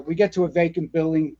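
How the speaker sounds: ordinary talk, nothing marked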